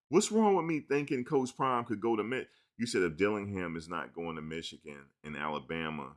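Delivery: ordinary delivery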